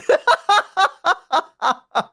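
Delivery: long high-pitched giggle; high laugh. Real, not acted. Laughing at herself.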